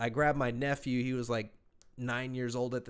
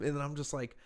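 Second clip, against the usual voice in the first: ordinary talk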